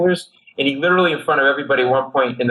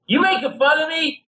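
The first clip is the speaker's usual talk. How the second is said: high-pitched imitated drunk challenge; drunken outrage; 13 semitones higher than usual. Slashed drunk to the comic, outraged. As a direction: bristle like an offended drunk. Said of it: "he's drunk, and he's going".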